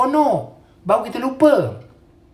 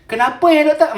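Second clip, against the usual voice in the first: mock questioning, higher and louder; pertanyaan pesakit; 7 semitones higher, 5 dB louder than usual. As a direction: imitate a patient pestering the doctor. Mimics questioning patient. (mengajuk pesakit bertanya, tinggi dan kuat)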